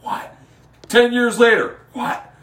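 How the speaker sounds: high loud heel rant; heel wrestler mic rant. Retold or imitated. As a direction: yell at the crowd like a heel. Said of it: "healed at the crowd and was like".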